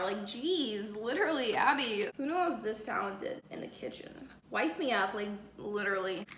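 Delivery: usual speaking voice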